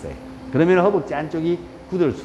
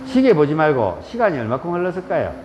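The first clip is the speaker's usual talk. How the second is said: ordinary delivery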